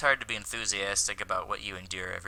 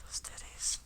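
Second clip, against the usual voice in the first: whispering; 11 dB quieter than usual. Real, not acted. Lewd aside under breath.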